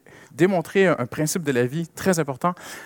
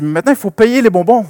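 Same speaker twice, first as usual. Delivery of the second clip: higher and louder firm demand; father insisting; 8 semitones higher, 14 dB louder than usual. Retold or imitated. Father at the counter telling his daughter to pay, firm and louder. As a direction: tell the child it's time to pay. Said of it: "j'ai dit".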